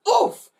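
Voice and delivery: high, loud thud reaction; cried oof. A narrator's reading. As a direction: yelp as if landing hard. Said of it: "she cried".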